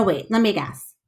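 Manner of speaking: usual speaking voice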